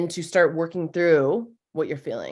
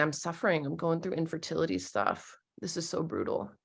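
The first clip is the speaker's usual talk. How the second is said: soft acknowledgment; 8 dB quieter than usual. Shown as a demonstration. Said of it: "like, acknowledging".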